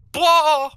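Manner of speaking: very high loud mock wail; enthusiastic blah. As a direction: blurt it out with overdone enthusiasm. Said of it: "Let me just put more enthusiasm into it".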